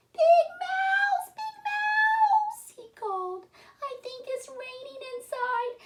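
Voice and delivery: high squeaky little mouse voice calling out. Acted. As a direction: call for help like a tiny scared mouse.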